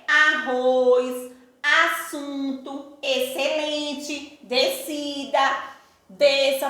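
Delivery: very slow word list, each syllable held apart; split into syllables. Shown as a demonstration. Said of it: "Na hora que vamos separar essas palavrinhas". (palavras bem devagar, separando as sílabas)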